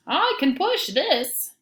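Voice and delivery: high, slow little duck voice; a small duck offering help, high and slow. Acted. Duck volunteering to the farm animals, high and slow. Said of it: "the duck says".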